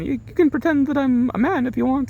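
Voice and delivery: high put-on come-on voice; seductive actress impression. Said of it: "she's, like, coming on to him".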